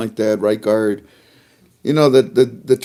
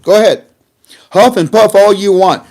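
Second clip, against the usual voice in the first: ordinary talk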